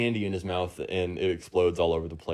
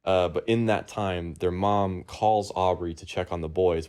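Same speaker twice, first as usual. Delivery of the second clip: ordinary talk, nothing marked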